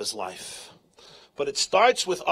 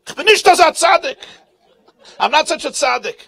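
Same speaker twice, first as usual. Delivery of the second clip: high, loud panicked scream; 12 semitones higher, 14 dB louder than usual. Acted. Preacher begs for his life, screaming. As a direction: scream out the denial in terror. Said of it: "So he gives a scream".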